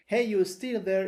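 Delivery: usual speaking voice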